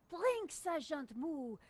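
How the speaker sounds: slow grand voice of France; France calling. Pretend. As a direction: summon him slowly, grandly. Voicing France calling the sergeant.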